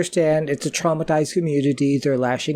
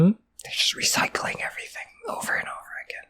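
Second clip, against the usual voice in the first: quick, hushed whisper; whispered aside; 7 semitones higher, 8 dB quieter, faster than usual. Real, not acted. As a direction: whisper it fast as a side comment. Quick aside during the movie.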